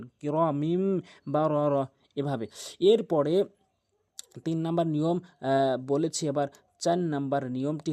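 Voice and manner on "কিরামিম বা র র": "কিরামিম বা র র" slow, the m held in a nasal hum; recited with ghunnah. Shown as a demonstration. Teacher models ghunnah for learners, slow "কিরামিম" with held m. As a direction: hold the final M of "কিরামিম" before "বা". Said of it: "কিরামিম গুণনা করবেন".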